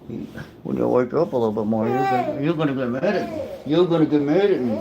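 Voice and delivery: goofy sing-song, pitch climbing at the end; playful silly voice. Pretend. Dad teasing his baby while wiping, playful silly voice.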